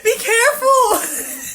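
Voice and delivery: high, drawn-out cry with laughter; retold alarmed warning. Driver retells warning the mailman, alarmed and high. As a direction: squeal a panicked caution, half laughing.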